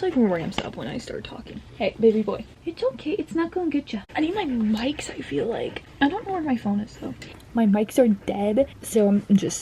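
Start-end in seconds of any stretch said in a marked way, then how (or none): 1.8-4.0 high pet talk. Real, not acted. Owner reassuring her panting dog mid-video, high baby talk. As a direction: coo reassurance up high to a pup.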